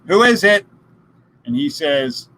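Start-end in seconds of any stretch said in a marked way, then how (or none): 0.1-0.7 woman asking again. Retold or imitated. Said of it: "And she says".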